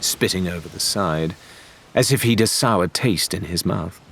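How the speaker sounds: usual speaking voice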